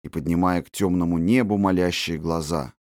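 usual speaking voice

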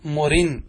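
مرن drawn out, ending on n; ya dropped, tanween. Shown as a demonstration. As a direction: say مرن as "murin". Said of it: "تحذف".

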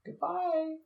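very high falsetto goodbye; sing-song goodbye. Real, not acted.

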